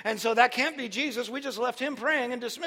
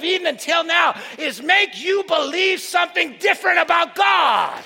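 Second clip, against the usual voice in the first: loud, high-pitched sermon delivery; full-force preaching; 7 semitones higher, 8 dB louder than usual. Real, not acted. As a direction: drive it home to the congregation, shouting.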